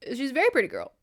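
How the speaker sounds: high-pitched, acting slightly choked up; mock choked-up admission; a little verklempt. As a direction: imitate a maid getting emotional. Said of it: "getting a little verklempt".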